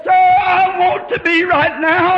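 high, loud, drawn-out retold cry; slow impassioned shout; desperate cry. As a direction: cry out slowly and fervently as the dying woman. Dying woman's desperate answer retold.

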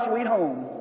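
usual speaking voice

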